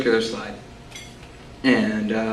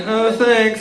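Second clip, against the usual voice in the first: sweet thanks; 9 semitones higher than usual. Retold or imitated. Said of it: "And I was like".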